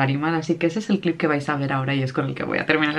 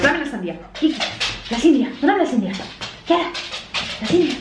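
high playful voice coaxing the dog; talking to a dog; 8 semitones higher, faster than usual. Real, not acted. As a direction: tease the dog, much higher pitch. Playing with dog over watermelon.

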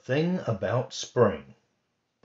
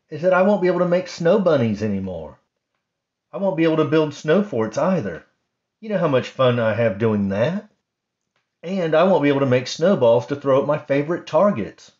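quick, higher complaining bunny voice; grumpy Rabbit; 6 semitones higher, 7 dB louder, faster than usual. Acted. Rabbit complaining to Bird and Mouse, lightly voiced grumpy bunny. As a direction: rattle off gripes like a sulky rabbit.

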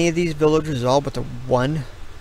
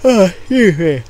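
yawning apology; 5 semitones higher, 8 dB louder than usual. Real, not acted. Tired builder apologising to viewers, yawning. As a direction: excuse me squeezed out through a loud yawn. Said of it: "I don't know why I'm yawning".

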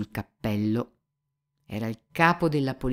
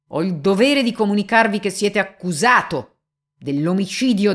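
firm stentorian declaration; 8 semitones higher, 7 dB louder than usual. Acted. Young examiner to Mitya, formally declaring the accusation. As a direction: announce it like a formal charge. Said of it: "con voce ferma e stentorea declamò gravemente".

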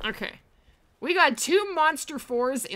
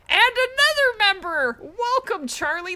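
excited; 8 semitones higher, 6 dB louder than usual. Real, not acted. New member joins the stream.

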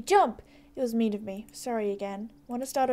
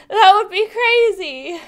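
nervous laugh; 6 semitones higher, 8 dB louder, slower than usual. Acted. Embarrassed character laughs it off.

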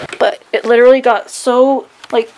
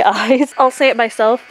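normal talking voice

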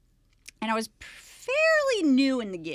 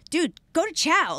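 higher-pitched, impatient command; exasperated order; 8 semitones higher, 4 dB louder than usual. Retold or imitated. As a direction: order him along, fed up.

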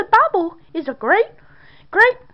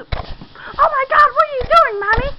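much higher, begging her to stop; frightened pleading; 8 semitones higher than usual. Acted. Son begs mother to stop hurting him, high frightened pleading. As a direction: plead in rising panic.

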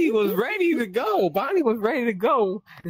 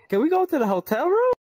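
much higher, louder coy invitation; mock flirty Freddy; 9 semitones higher, 4 dB louder than usual. Friend imitating Freddy asking to go to the hotel room. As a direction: ask it sweetly like a flirting robot bear.

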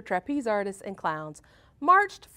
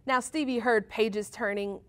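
ordinary delivery, nothing marked

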